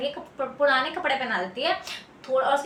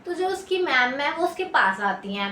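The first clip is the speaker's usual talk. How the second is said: ordinary delivery, nothing marked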